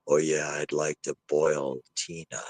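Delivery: low, soft, slow spirit imitation; hushed, slow imitation of a disembodied man; eerie male voice. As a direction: replay the ghostly man's words low and drawn out. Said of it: "A man's voice or a male voice in tone".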